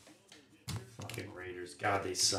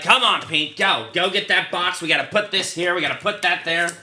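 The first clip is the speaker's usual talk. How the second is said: pushy spouse giving orders; bossy wife voice; 6 semitones higher, 5 dB louder than usual. Retold or imitated. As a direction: nag him to keep hauling boxes. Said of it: "make my wife sound like she's such a bitch".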